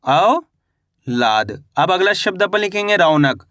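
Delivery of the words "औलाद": औलाद split in two, long rising औ, gap, then falling लाद; teacher slowly sounding out औलाद in two parts; syllable by syllable. Shown as a demonstration. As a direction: draw out औ upward, pause, then drop on लाद. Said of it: "तो मिलके बन गया".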